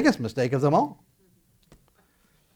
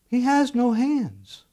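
pot talking back; 10 semitones higher, much slower than usual. Retold or imitated. The made thing talks back.

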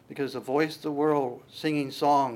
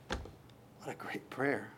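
slow, softer whisper of wonder; awed whisper; 3 semitones lower, 12 dB quieter, much slower than usual. Retold or imitated. Pastor voicing a listener awed by Jesus's prayer. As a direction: breathe it out in awe.

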